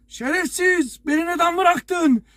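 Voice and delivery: high-pitched mock yell, slow on the second line; retold furious yell. Stranded friend yelling down the phone, high angry yell. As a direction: yell it like a stranded friend who was abandoned. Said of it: "Nasıl bağırıyor telefonda?".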